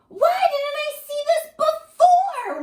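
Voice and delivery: high, loud amazed wonder; wondering aloud. Acted. Little monster amazed by the snow, asking in wonder. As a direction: marvel at the snow as if seeing it first. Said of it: "wondered Max".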